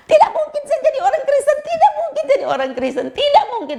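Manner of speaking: very high, strained cry of refusal; retold screaming. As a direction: scream it out in protest. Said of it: "saya teriak lagi".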